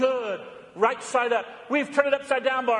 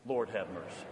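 hushed liturgical plea; 12 dB quieter than usual. Shown as a demonstration. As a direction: murmur it together with the congregation. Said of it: "Say it with me".

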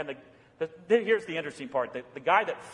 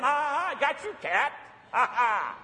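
higher, mock-triumphant taunt with a laugh; gloating at the cat; 7 semitones higher than usual. Pastor gloats at his cat after shutting the door, mock triumph. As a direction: crow over fooling the cat.

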